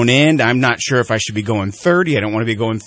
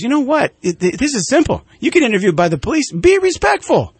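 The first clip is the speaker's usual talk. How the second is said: much higher, slowing to lay down the rule; Chris Rock impression; 11 semitones higher than usual. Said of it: "He goes".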